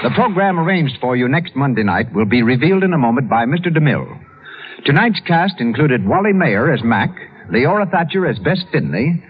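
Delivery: ordinary delivery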